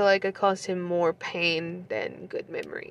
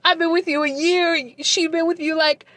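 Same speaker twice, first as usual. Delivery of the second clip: raised, high-pitched angry rant; mad; 8 semitones higher, 4 dB louder than usual. Retold or imitated. Telling the ex off about the new girlfriend, mad. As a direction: pitch it high and loud, indignant and jealous. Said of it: "I was mad".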